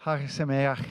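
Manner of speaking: Hebrew greeting in a Sicilian accent; self-mocking Sicilian-accented Hebrew. Shown as a demonstration. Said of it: "Hebrew with a Sicilian accent I can muster".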